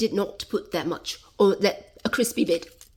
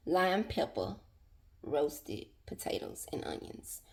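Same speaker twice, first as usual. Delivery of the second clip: whisper; 6 semitones lower, 12 dB quieter than usual. Acted. Repeats dish name under breath.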